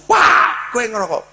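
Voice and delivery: high, insistent accusing question; stern demand. Retold or imitated. Father demanding the truth from his son, high and insistent. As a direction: corner him with the question.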